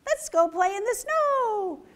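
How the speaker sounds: high, loud gleeful cheer; excited rabbits. Acted. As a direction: bubble over with eager glee. Rabbits eager to play.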